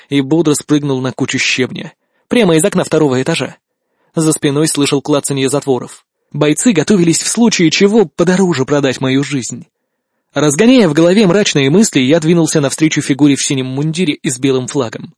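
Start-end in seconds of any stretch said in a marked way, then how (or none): none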